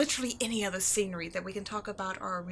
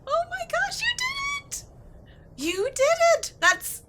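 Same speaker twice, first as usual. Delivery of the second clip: much higher and louder, squealing with delight; overjoyed; 9 dB louder than usual. Acted. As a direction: burst out like a dream just came true. Riddle solved, bursting with joy.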